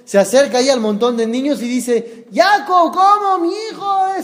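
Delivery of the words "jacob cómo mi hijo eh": high-pitched hysterical wail, acted; hammed-up mother's panic, pitch soaring; frantic mother. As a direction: cry out for your son, far higher. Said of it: "se volvió loca".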